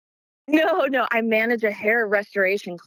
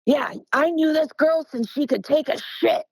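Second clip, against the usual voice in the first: lightly voiced buddy impression; a hint of A C's voice; imitating A C; 6 semitones higher than usual. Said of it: "this is A C".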